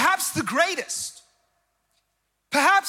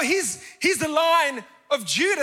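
ordinary delivery

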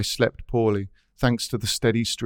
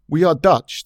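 loud, higher-pitched, hearty declaration; vigorous; 6 semitones higher, 7 dB louder than usual. Acted. Dutch cyclist to the narrator, vigorous. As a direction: declare it with full vigour. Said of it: "the man said in a vigorous voice".

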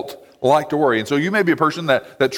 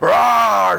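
loud, higher roar to scare off a bear; a loud pretend war cry at a bear; mock roar at a bear; 6 semitones higher, 4 dB louder than usual. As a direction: bark a sudden "Rah!" like standing up to a bear. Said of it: "scream back at the bear".

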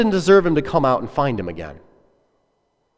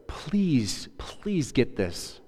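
whispered, slow pleading please; a father begging his son softly; tender whispered plea; 10 dB quieter, much slower than usual. Acted.

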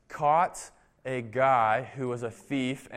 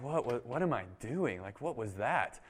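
softer, lower, baffled muttering; bewildered to himself; 3 semitones lower, 7 dB quieter than usual. Retold or imitated. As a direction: mutter in disbelief. Officer bewildered after letting go.

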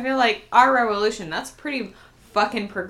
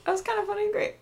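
higher, sing-song self-praise; mock reply; 13 semitones higher, 5 dB quieter, faster than usual. Performer repeats the faint praise in a higher mocking voice. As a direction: parrot the compliment back cheekily.

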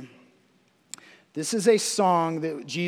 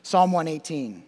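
usual speaking voice

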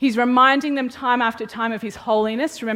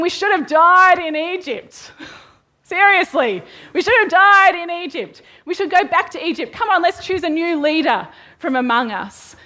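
high, loud whiny complaining crowd; hammed-up grumbling Israelites wanting Egypt; mock wailing complaint; 7 semitones higher, 5 dB louder than usual.

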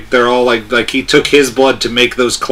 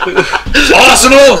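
put-on English accent; 11 semitones higher, 3 dB louder, much slower than usual. Mocking British football fan.

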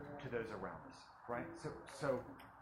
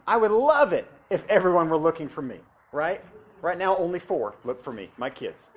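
preaching at full force; 3 semitones higher, 13 dB louder than usual. Acted. Reads scripture, preaching loud.

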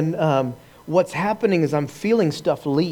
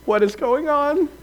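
bewildered; 11 semitones higher, slower than usual. Retold or imitated. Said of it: "you're like".

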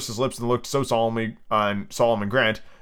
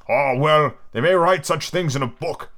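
usual speaking voice

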